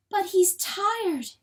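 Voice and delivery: high, slow, anguished plea; pleading. A narrator's reading. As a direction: plead for him, stretching the words. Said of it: "she pleaded".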